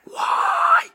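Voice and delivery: sudden high, loud yelp of exasperation; exasperated cry. Retold or imitated. Viewer at the father on screen, loud exasperated high cry. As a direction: burst out in a squeaky high yell. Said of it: "it's like".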